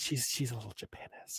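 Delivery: unvoiced hush of a secret; whispered. Friend whispering the joke word to his cohost. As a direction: whisper it like gossip.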